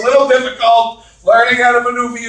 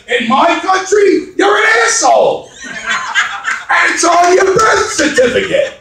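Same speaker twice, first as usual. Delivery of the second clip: mock angry retort; 7 semitones higher than usual. Comedian telling off his foreign brother-in-law, mock angry. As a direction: climb higher as the insult builds.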